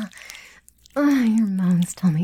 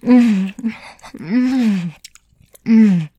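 loud closed-mouth moans; pleasured moan; 2 semitones higher, 10 dB louder, much slower than usual. Acted. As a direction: moan hard through closed lips. Intimate scene, wordless moaning.

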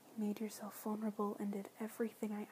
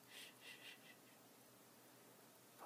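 faint hushing to lull; fading shh sounds; soft shushing; 22 dB quieter, much slower than usual. Acted. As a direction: shush him gently to sleep. Soothing him to sleep.

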